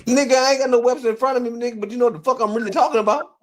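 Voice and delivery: high-pitched, indignant protest; worked-up and defensive; exasperated protest. Real, not acted. Heated argument over word meaning.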